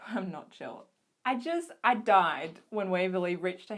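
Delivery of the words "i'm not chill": very soft, lowered confession; small, hushed giving-in; weak admission. Real, not acted. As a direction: let the pretence drop, almost under your breath. Admits she is overwhelmed after feigned calm.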